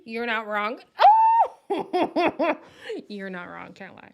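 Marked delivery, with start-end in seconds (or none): 0.8-3.0 high, loud cackle of real laughter; loud burst of laughter. Real, not acted. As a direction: let the laugh explode. Cracking up at comparison.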